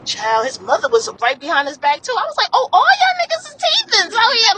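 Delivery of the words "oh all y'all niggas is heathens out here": retold outrage. Retold outrage at his family.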